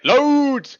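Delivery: very high, loud shout with a Scottish long O. Shown as a demonstration. Co-host correcting how the fisherman shouted, high Scottish shouting. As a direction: holler it shrieking high, stretching the accented O. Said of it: "He was shouting".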